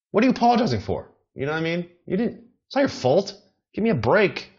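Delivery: high-pitched, exasperated rant; incredulous protest. Acted. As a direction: ask it like it's absurd, voice shooting up. Mock protest at apologisers.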